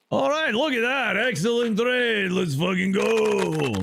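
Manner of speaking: high, whooping victory cheer; drawn-out celebratory yell; triumphant hype. Acted. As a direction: crow over the win like a champion. Celebrating a good in-game trade.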